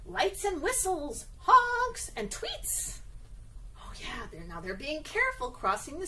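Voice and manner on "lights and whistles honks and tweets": sing-song high. Acted. Rhyme read to children.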